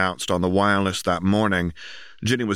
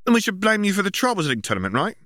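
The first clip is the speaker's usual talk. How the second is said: lightly voiced girl; 13 semitones higher, faster than usual. A narrator's reading. Ginny's voice, fast.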